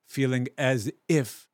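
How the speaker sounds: ordinary talk, nothing marked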